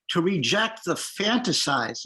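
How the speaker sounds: slow down and lean hard on the word fantasized; stress on fantasized. Shown as a demonstration. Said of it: "and I emphasize the word fantasized".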